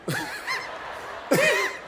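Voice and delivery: a sudden squeaky high-pitched giggle; high squeal laugh. Real, not acted. Mocking the stranger who set his time, squealing laugh. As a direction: let the laugh jump way up into falsetto.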